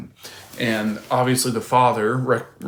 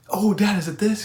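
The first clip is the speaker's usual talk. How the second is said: lightly voiced young boy, pitched higher; a hint of an excited kid asking dad; mild childlike eagerness; eager little son; 9 semitones higher than usual. Son picking toys in parable.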